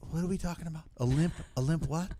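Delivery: put-on soft, confused old politician; Joe Biden impression. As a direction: act lost and puzzled by the question. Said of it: "Biden said".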